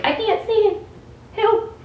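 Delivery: high whiny mock cry for help; mock distress. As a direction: squeal it like a helpless kid. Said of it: "I was like".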